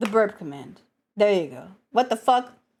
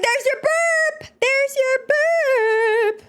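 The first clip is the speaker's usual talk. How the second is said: very high, drawn-out squeal; hammed-up squeal; 13 semitones higher, slower than usual. Streamer delivering the promised burp, high mock squeal. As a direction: squeal it slowly, stretching BURP.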